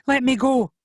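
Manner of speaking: put-on high, loud girl's yell; yelled demand. A narrator's reading. Maggie yells at Xavier to release her, high yell. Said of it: "Maggie yelled at Xavier".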